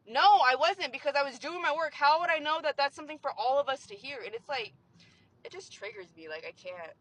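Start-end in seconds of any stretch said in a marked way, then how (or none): 0.1-2.3 loud, exasperated comeback; raised-voice, defensive no; indignant denial. Retold or imitated. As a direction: push back hard on the accusation. Acted retort to coworkers.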